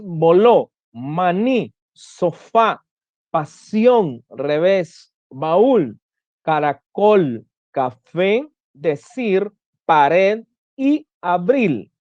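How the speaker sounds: voló, maní, sofá, pasión and the rest, each word separate with its ending stressed; word-by-word demo, voló to abril, final syllable prominent; stress on last syllable. Shown as a demonstration. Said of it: "el acento en la última sílaba".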